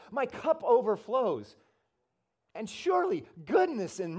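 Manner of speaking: the preacher declaring the Lord is his shepherd; preached psalm. Acted. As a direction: proclaim the psalm with rising fervor. Recites Psalm 23 in full force.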